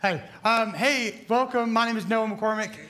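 ordinary delivery

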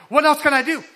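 really keen to be told the next task; eager plea. Retold or imitated. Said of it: "but, like".

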